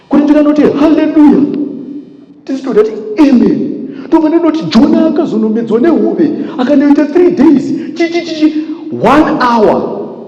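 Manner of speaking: very high-pitched "powerful speaker" preaching act; mock shouting preacher. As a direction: send the voice through the roof like an overexcited pastor. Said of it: "vanhu vanofunga voice riri padenga".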